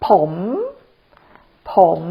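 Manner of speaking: ผม low with a rise; rising tone. Shown as a demonstration. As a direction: demonstrate the rising tone on ผม. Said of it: "So rising tone".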